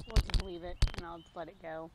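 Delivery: ordinary talk, nothing marked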